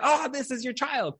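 high, quick oohing over a newborn; imitated exclamation.

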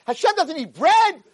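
high, slow, emphatic yell; acted exasperated rebuke; furious scolding. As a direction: lay down the law as an outraged rabbi. Rabbi berates the naive man.

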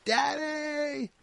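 very high, drawn-out urgent cry; imitated piercing kid's plea; heartfelt child's scream. Shown as a demonstration. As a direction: call out desperately for Dad from the heart. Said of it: "totally different".

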